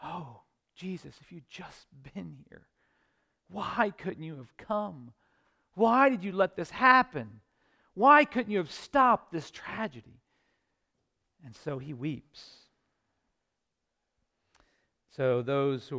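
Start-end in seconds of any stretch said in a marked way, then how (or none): 0.0-10.2 grieving plea. Acted. Mary at Jesus' feet pleading, hushed grief building to a cry.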